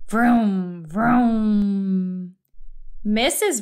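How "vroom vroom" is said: drawn-out engine vrooms; sleeping car noise. A narrator's reading. Mister Watson dreaming of driving, long sleepy vrooms. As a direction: hum out two long engine revs. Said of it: "said Mister Watson in his sleep".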